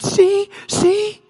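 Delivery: high, mock-alarmed see, see; alarmed woman. Preacher voicing a woman startled by a floating flashlight, high mock alarm. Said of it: "And she said".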